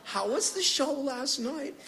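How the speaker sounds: high, slow mom voice; imitated doting mom. Mom picking up to her son, imitated high cooing voice. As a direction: coo it in a high, slow put-on voice. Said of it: "you hear her pick it up".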